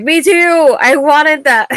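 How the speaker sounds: high, loud fangirl outburst; excited fan reaction. Retold or imitated. Fan's gleeful reaction retold.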